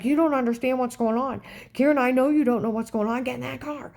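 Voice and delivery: mock fluster, urging her into the car; frantic pleading. Dave begging her to get in the car, mock panic. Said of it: "He's like".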